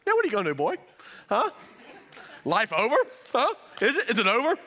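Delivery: loud, high-pitched goading; mock taunting dad. Dad gloating to son over a stomped balloon, mock taunting. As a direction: taunt loudly in short jabbing questions.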